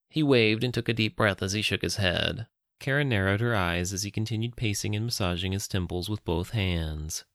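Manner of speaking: plain reading voice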